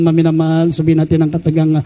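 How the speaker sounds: usual speaking voice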